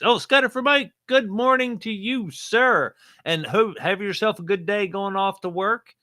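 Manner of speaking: high, bright radio greeting; cheerful shout-out. Acted. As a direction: beam it out like a DJ. Reading a listener shout-out.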